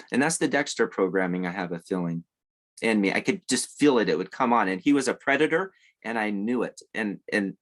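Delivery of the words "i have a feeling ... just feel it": ordinary talk, nothing marked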